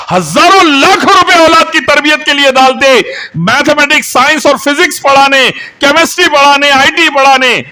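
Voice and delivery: high, loud, hammering sermon delivery; impassioned litany, shouted high; fiery preaching. Real, not acted. Preaches at full force.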